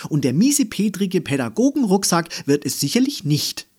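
fast, high, loud refusal; firm retort. A narrator's reading. Girl rejecting the grumpy teacher's backpack, firm retort. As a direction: rattle it off with determination. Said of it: "entgegnete Andy bestimmt".

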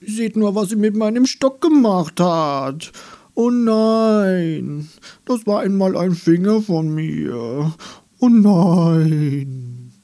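viel höher, lauter, weinerlich klagende Baumstimme; jammernder Baum. Acted. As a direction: wie ein beleidigter Baum lamentieren. Klagender Baum beschwert sich. (der Baum klagt über den Stock und seinen Finger)

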